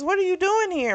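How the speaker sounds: high-pitched, puzzled question; mock surprised question. Prince surprised to find Francis at the door, high puzzled question.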